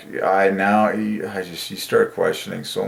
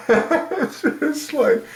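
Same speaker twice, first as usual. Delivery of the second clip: high mock-tearful wail dissolving into laughter; kids crying, laughed through; slower than usual. Kids who can't keep up with dad, tearful laughing. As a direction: wail it helplessly, then crack up. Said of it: "They just start crying".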